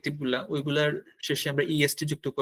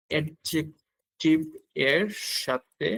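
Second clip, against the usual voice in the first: spoken slowly in pieces, in time with writing; slow dictation; much slower than usual. Real, not acted. Teacher writing it out for learners, slow dictation. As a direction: draw out each syllable slowly.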